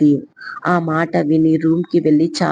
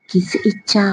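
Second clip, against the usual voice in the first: ordinary delivery, nothing marked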